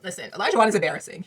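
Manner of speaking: loud, fast exasperation; exasperated. Real, not acted. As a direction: blurt it out, fed up. Exasperated outburst.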